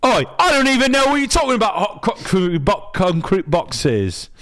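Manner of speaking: high, fast hammed-up Cockney accent. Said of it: "Cockney accent".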